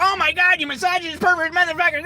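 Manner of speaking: high shrieking mock outrage; outraged woman. Comic imitating an outraged woman, shrieking high. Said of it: "She comes in there and goes".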